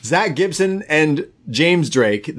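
ordinary talk

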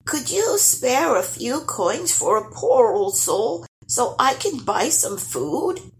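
high-pitched, slow pleading; begging dwarf. A narrator's reading. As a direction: plead like a poor old soul asking for alms. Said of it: "begged the dwarf".

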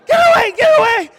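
very high, loud, fast shrieking; panicked shriek. Retold or imitated. Pastor acting out him and his wife fleeing a moth, terrified shriek. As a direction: shriek it in pure terror. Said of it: "We're both literally going like this".

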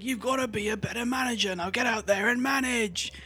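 bossy celebrity chef, hammed up; mock Gordon Ramsay. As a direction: Gordon lecturing the owner, mock stern. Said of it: "He's just like".

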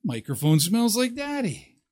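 a hint of a soft dog voice; dog's thoughts. Owner voicing the dog sniffing the mic, soft. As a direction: voice the dog, hushed. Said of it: "You're thinking".

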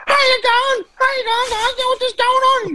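hammed-up chirpy cleaner voice; imitating annoying cleaner. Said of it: "She goes".

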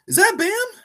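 retold burst of excited recognition. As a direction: blurt it out in excited surprise. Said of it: "I got excited".

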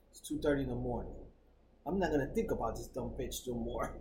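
low, soft resolve; self-talk. Acted. Man awake at two thirty telling himself, low self-talk. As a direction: talk yourself down quietly, under the voice. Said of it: "you tell yourself".

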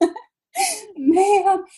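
loud, high desperate call; calling for help. Retold or imitated. Panicked shopper calling to the store clerk, loud desperate call.

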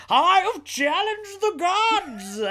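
high, slow, theatrical declaration; hammy overacted. Mocking a hammy radio Frankenstein, overacted. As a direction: ham it up for the back seats. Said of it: "And this one is like".

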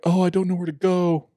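high, loud worried man voice; lost man's voice. Retold or imitated. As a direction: play the lost rider, high and pleading.

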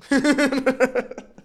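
carcajada aguda y fuerte, de golpe. Real, not acted. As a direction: suelta una risa alta y explosiva. Carcajada tras chiste de Ubu. (se ríe a carcajadas del chiste del teclado)

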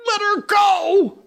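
high, loud, slow outraged shout; furious cry. Acted. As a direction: explode at the news. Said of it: "cried Hook".

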